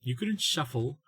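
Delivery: normal talking voice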